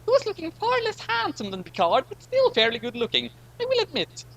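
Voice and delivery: very high, catty aside; high-voiced catty aside about Riker; high character voice. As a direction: pitch way up and tease him.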